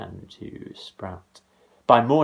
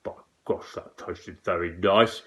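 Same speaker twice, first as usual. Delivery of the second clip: whispered then low giant mutter; 2 semitones lower than usual. A narrator's reading. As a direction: mutter with relish.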